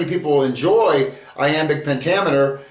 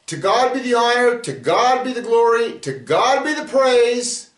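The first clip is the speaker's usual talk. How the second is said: high, proclaiming benediction, each line ringing out; closing doxology; 9 semitones higher than usual. Acted. Teacher closes the Bible study, proclaiming the doxology. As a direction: lift it up like a blessing over the congregation.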